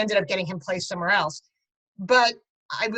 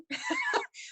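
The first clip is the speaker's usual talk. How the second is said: brief high-pitched laugh; short laugh; 8 dB quieter than usual. Real, not acted. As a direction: laugh lightly at your own words. Laughs at own self-description.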